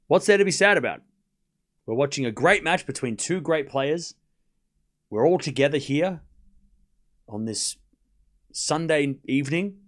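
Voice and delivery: ordinary delivery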